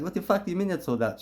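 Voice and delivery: high, fast angry outburst, imitating the caller; mad caller. Caller phones about the sold-out event, mock mad. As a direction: imitate an irate woman demanding an explanation. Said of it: "She's mad".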